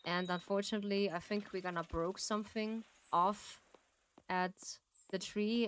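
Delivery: ordinary talk, nothing marked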